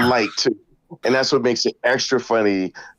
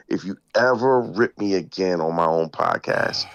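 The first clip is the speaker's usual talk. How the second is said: imitated threat; 8 semitones lower than usual. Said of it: "he showed me and he says".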